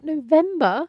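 high-pitched incredulous echo; stunned questioning echo. Retold or imitated. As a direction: repeat it back in disbelief, voice rising. Retelling her reaction to the date.

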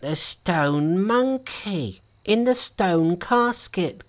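put-on slow, gasping old man; breathless old person. A narrator's reading. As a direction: gasp it out in awe, slowly. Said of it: "gasped the old man".

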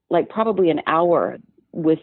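usual speaking voice

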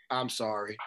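low, hushed Southern apology; Southern accent. Retold or imitated. Retelling to co-host how the man apologized, Southern accent. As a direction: imitate a soft-spoken Southerner apologizing. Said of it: "with a southern accent".

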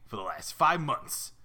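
talking goldfish with a New York rasp; raspy New Yorker. Acted. Caged fish wanting to play, raspy New York voice. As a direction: plead your case like a rough New Yorker. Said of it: "They all sound like raspy New Yorkers".

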